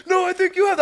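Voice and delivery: high loud put-on caller; confused scammer. Scammer answering him, high loud confused voice. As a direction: play her baffled reply. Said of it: "she's like".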